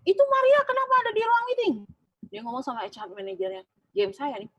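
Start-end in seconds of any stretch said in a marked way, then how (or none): 0.1-1.7 high, loud, indignant demand; a general manager outraged someone junior is in his meeting; indignant boss. Retold or imitated. GM asks HR manager why she's here, high and indignant. Boss angrily questions her presence.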